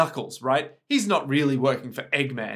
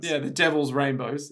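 ordinary delivery

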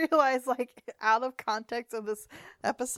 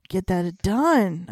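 loud, very drawn-out declaration; slow, emphatic mock resolve; mock conviction; 6 dB louder, much slower than usual. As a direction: stretch it out with playful determination. Mock conviction about a chore.

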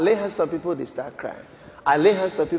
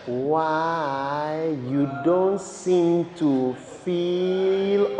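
very slow, stretched, near-chanted words; drawn-out challenge; 5 semitones lower, 4 dB quieter, much slower than usual. Real, not acted. Preacher tells listeners what they should wonder, drawn-out challenge. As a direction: stretch every vowel out long.

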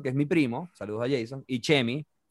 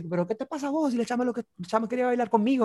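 retold yell; 10 semitones higher than usual. Drunk Chemi yelling at the jealous boyfriend, high indignant. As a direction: argue back, offended. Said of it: "le empieza a gritar al coño así".

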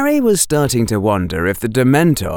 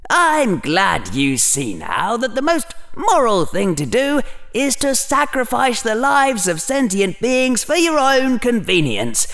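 lightly voiced scheming Slytherin; inner Slytherin, gloating; 10 semitones higher than usual. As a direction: lay on the mock approval with swooping emphasis. Slytherin gloats over Harry's reasoning.

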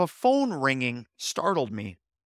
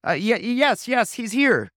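babbling stammer; 6 semitones higher, 3 dB louder than usual. Retold or imitated. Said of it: "gain control of my babbling".

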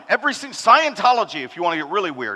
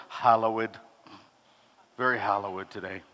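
much lower and softer, mock-pious deadpan; 10 semitones lower, 7 dB quieter than usual. Pastor mocking a churchgoer's answer about the service, mock-pious and low. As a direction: answer like a stiff churchgoer who's taking it all too seriously.

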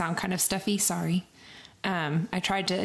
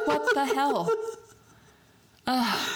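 exasperated; 7 semitones higher, slower than usual. Retold or imitated. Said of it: "And it's like".

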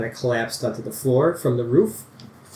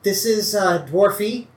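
put-on higher, hesitant young farmhand; a lightly raised, bumbling young man; Tabi's voice; 9 semitones higher, slower than usual. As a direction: stammer it out, nervous and eager. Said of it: "He's like".

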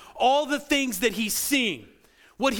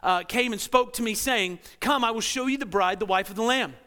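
measured, lower scripture reading voice; 3 semitones lower than usual. Acted. Pastor reading Revelation to the congregation, lower and measured.

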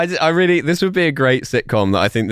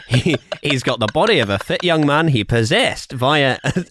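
usual speaking voice

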